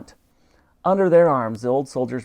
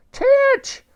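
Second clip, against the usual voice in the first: very high loud cry of his name; shrieked name; anguished call; 7 dB louder than usual. A narrator's reading. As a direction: call out in terror. Dottie still screaming.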